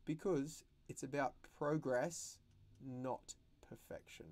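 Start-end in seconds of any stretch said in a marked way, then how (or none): 2.8-4.2 whispered. Real, not acted. Teacher's soft aside to kids.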